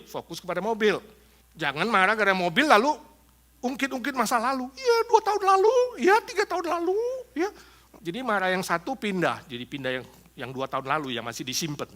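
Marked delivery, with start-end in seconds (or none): 4.8-7.5 put-on high-pitched nagging about old grudges; hammed-up, high complaining voice; mock nagging. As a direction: whine it high, piling up past grievances. Acts spouse dredging up old grievances.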